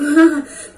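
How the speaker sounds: a short self-conscious laugh breaking out; embarrassed laugh. Real, not acted. Telling viewers about tears at church, embarrassed laugh. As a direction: laugh off the tears, a little loud. Emotional memory of crying.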